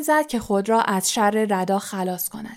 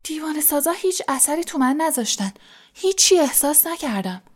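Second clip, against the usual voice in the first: eager, higher-pitched report; enthusiastic; 6 semitones higher than usual. A narrator's reading. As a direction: lift the pitch with excitement. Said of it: "هری با شور و شوق گفت".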